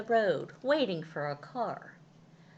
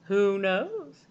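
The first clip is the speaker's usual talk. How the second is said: Missus Tucker; 3 semitones higher, 8 dB louder than usual. A narrator's reading. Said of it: "said Missus Tucker".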